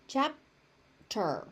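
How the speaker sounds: "Chapter" split as chap... ter; syllable by syllable. Shown as a demonstration. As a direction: hold a gap after the P in "Chapter". Teacher breaks word into syllables.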